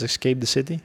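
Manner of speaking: normal talking voice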